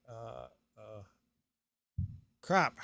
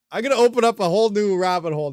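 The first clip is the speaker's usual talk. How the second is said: fast, louder, higher rush of excitement; eager rush; 6 semitones higher, 10 dB louder, faster than usual. Real, not acted. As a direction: race ahead with glee. Excited about new topic.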